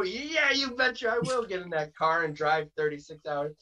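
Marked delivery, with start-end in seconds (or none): 0.0-1.4 high-pitched, over-eager yes; eager acceptance. Acted. Wrestler saying yes to another booking, mock eager. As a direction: jump at the offer in a much higher voice.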